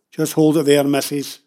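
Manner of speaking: loud, firm challenge; stern warning. Acted. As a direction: warn them off loudly. Father warns off protesters.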